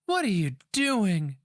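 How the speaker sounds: high, loud alarmed cry; girl crying out. A narrator's reading. Young girl crying out to the old woman, high and loud. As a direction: cry it out in shock. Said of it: "the girl cried".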